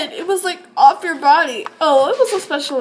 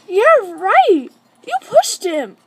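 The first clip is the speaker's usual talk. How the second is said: scoffing; 6 semitones higher, 4 dB louder, slower than usual. Acted. Arguing over the fall.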